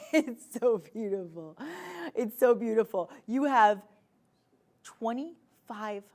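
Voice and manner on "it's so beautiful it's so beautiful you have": laughing gush. Real, not acted. Gushing over bracelet.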